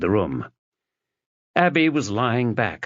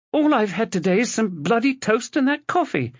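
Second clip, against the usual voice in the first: high, agitated answer; frightened snap; 14 semitones higher than usual. Acted. Wife answering her husband high and agitated. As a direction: blurt it out scared and cross.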